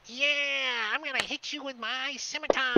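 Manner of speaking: high, squawky goblin threat; high-pitched put-on monster voice; attacking goblin voice. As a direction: threaten like an over-eager little goblin. Goblin attacks, swinging scimitar.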